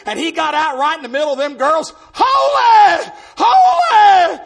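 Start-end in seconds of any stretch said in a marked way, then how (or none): 2.1-4.4 high, loud revival holler; Brother Paul. Retold or imitated. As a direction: shout it as an imitated outburst of worship. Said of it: "He heard that screaming and running".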